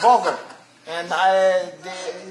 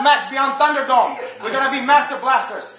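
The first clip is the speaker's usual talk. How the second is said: excited shout; 5 semitones higher, 6 dB louder than usual. Acted. Hyped boast to the crowd.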